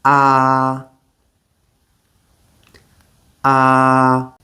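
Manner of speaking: "Ah" with mouth wide open, relaxed; Mandarin a vowel. Shown as a demonstration. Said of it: "Your mouth should be wide open".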